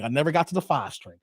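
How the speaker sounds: usual speaking voice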